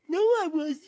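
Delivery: high, whiny denial; put-on child voice; protesting little bear. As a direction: whine it like a wronged child. Baby Bear denies it.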